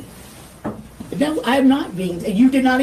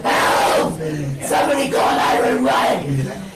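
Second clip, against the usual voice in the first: frantic calls for help; screaming for help; 3 semitones higher, slower than usual. Real, not acted. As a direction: scream for anyone to help you. Calling for help during arrest.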